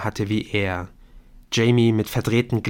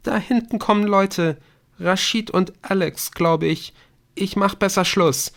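put-on high-pitched girl's voice; upset girl pulling herself together; Bryn, recovering her composure; 10 semitones higher than usual. A narrator's reading. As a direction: wrap up the call in a high voice. Narrator voices distraught girl on phone.

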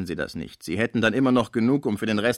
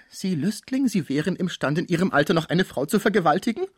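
mock outrage; 8 semitones higher, 4 dB louder than usual. Cibot feigns outrage at Schmucke.

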